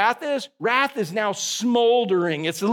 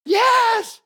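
excited yes; 13 semitones higher, 4 dB louder than usual. Real, not acted. Enthusiastic affirmation.

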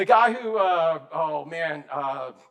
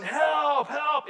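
high mock cry of help, raised in pitch; yelling for help; 7 semitones higher than usual. Slave ship captain overboard calls for help, raised mock yell. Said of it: "he's yelling".

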